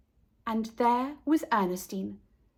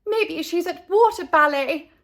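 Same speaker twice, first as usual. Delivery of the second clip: higher, louder hopeful call; 6 semitones higher, 6 dB louder than usual. A narrator's reading. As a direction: call it out to her husband. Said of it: "called Missus Buckmeister".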